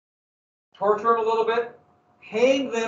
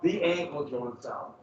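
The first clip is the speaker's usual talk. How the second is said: slow grim emphasis; 3 semitones lower, slower than usual. Acted. Dwelling on torture details.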